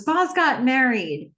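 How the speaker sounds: mildly acted proclamation; announcing news. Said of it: "She announces".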